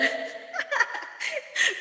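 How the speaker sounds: embarrassed after tripping over a viewer's name, high giggle; high breathy giggle. Real, not acted. High unvoiced giggle. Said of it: "nabubulol ako dito magbasa".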